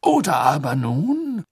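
high, slow, faltering minister; fussy official caught off balance; embarrassed. A narrator's reading. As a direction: stretch it out awkwardly. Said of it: "Fudge klang verlegen".

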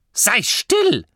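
high, loud granny snap; automatic shush. A narrator's reading. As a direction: cut him off out of habit. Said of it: "sagte Oma Morky automatisch".

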